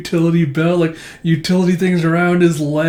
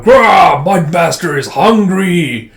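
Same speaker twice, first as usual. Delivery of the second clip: loud, raised, slow monster shout; swamp monster roar; 4 semitones higher, 8 dB louder, much slower than usual. Acted. Giant swamp monster announces its hunger, roaring. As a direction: bellow like a giant mud monster. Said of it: "this giant, like, Sandman, Swamp Thing looking monster going".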